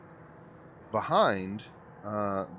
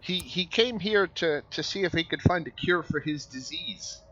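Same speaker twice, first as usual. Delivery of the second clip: higher, anxious stammering stranger; nervous sick man; 8 semitones higher, 3 dB louder than usual. Acted. GM nervous NPC voice.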